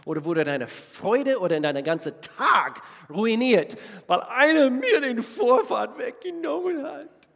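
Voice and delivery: sudden high, loud mock indignation; mock outrage. Preacher mocking a driver who cut him off, hammed-up outrage.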